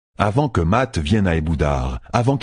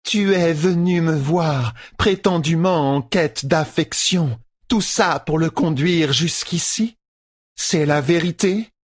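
high, slow indignant questioning; Tuon accusing; 10 semitones higher, slower than usual. A narrator's reading. Empress confronts Matt about Rand, accusing. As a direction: press the charge in a high, drawn-out voice.

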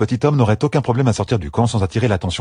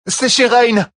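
high, loud woman's cry; exclaiming woman; 5 dB louder, slower than usual. A narrator's reading. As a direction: cry out the name in alarm. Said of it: "s'écria la première femme".

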